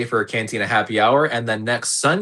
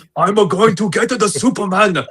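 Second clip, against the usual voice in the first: higher, hammed-up anime samurai; mock samurai voice; 7 semitones higher than usual. Co-host parodies a Korean Lex Luthor, mock samurai voice. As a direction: play a cartoon samurai with a heavy put-on accent. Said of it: "sound like a samurai".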